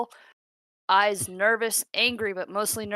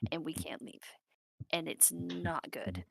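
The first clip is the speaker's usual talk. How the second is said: hushed, slowing down on the bad news; grave; 2 semitones lower, 10 dB quieter than usual. [name] tells the group they are trapped, grave and hushed.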